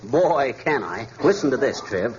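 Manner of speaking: ordinary talk, nothing marked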